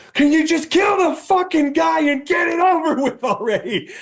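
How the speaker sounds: very high-pitched exasperated mock scream; imitated screaming. Fed-up viewer recalling yelling at a movie, screaming. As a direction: scream it at the screen, voice shooting way up. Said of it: "I was screaming".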